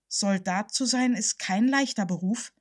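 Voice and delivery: usual speaking voice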